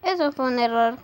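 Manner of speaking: "Eso fue un error" shouted, hitting "Eso" hard; a loud, angry "Eso fue un error"; loud accusation. Acted. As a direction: fling "Eso" at him, loud and sharp. Couple quarrel, retake loud.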